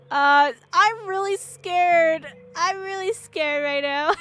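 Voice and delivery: hammed-up whimpering fear, high and very slow; mock scared. Playing up her fear of the ghost, mock crying. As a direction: stretch it out like a fake sob.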